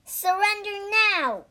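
high, forceful battle shout; fierce high challenge mid-fight; battle cry. Acted. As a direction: challenge the foe to yield.

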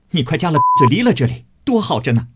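high, loud angry scolding; furious scolding. Acted. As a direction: spit the words at her in rage. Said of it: "指着他骂道". (愤怒地高声斥骂)

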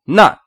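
"not" said strong and full; emphatic demo of "not"; stressed. Shown as a demonstration. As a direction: put full weight on "not". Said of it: "not" 重读".

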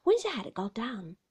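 usual speaking voice

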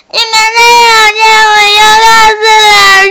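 high, loud tearful wail; crying threat. Acted. Dolphin crying and threatening to tell the teacher. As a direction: sob out the threat. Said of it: "小海豚就开始哭了".

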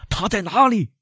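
high, strained shouted question; frantic high-pitched cry; urgent shout. A narrator's reading. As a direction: demand the doctor in alarm. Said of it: "威勒夫喊道".

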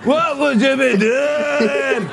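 high, drawn-out mock Scottish cry; Scottish accent. Interviewer hams up a fan's question, high Scottish accent. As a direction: belt it out slowly in an over-the-top Scottish brogue. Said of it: "do your Scottish accent again".

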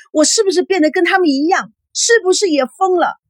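high, desperate questions; distraught, near-hysterical pleading; frantic questioning. A narrator's reading. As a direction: beg the nurse for an answer. Hysterical daughter questioning the nurse.

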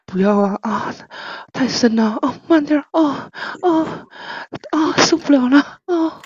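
put-on pleading moans between short phrases; hammed-up breathless pleading; mock moaning. Streamer doing a forfeit for co-hosts, mock moaning.

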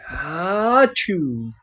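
ordinary delivery, nothing marked

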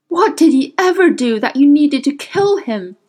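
high, impassioned demand; anguished cry. A narrator's reading. Will knocking the witch's hand away, anguished cry. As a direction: cry out for an answer, pained. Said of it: "he cried".

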